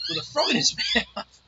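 can't get the word out for laughing; laughing through it. Real, not acted. Teller cracks up mid-punchline.

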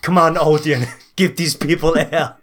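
hammed-up Austrian action-hero accent; mock Arnold Schwarzenegger accent. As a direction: order them about in a mock Austrian accent. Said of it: "What accent are you using".

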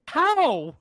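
baffled. Retold or imitated. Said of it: "And I'm like".

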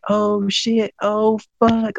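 high-pitched, drawn-out awed exclamations; mock amazed spectators; imitated audience reaction. Imitates people reacting in front seats.

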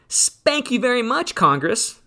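high, mocking spank-you to Congress; sarcastic thanks. Real, not acted.

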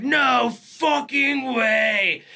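slow, drawn-out high shout of disbelief; stunned disbelief. Retold or imitated. Man seeing his friend's barn on fire, shouting in disbelief. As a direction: shout it slowly, staring at the flames.

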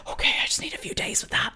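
hushed whisper, breathy and low. Retold or imitated. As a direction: whisper it like a secret excuse. Guests stalling on a tagline.

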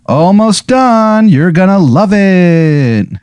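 high, slow sing-song call from upstairs; high drawn-out call from another room; Betsy Ross yelling. Acted. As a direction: call down sweetly, stretching every word. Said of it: "Betsy Ross yell".

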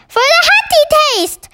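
high, loud giant's outburst; excited cry. A narrator's reading. BFG butts in on the army head, crying out high. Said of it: "cried the B F G".

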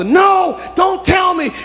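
high shouted denial; anguished shout. Acted. Martha shouting denial at the doctor. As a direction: cry out against the news. Martha refusing death news.

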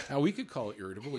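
fast, laughing as he speaks. Real, not acted. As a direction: chuckle through the words. Joking about the word irritable.

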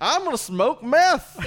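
high, loud hammed-up shout; shouted joke. Topping the bit with a high mock shout.

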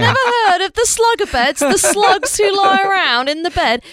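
high-pitched, comically indignant British voice; hammed-up British accent. Hammed-up British banter over slang.

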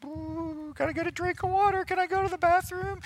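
very high, tiny squeak of dread; mock-nervous high-pitched whimper; frozen, panicked kid. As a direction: squeak out excuses like a kid desperate to escape. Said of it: "They were just frozen".

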